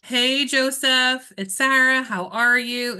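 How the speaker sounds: drawn-out, loud, upbeat hello; demonstrated phone greeting. Shown as a demonstration. Coach modelling an agent's call to a client, upbeat and drawn out. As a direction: open a client call with a big, stretched greeting. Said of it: "It goes like this".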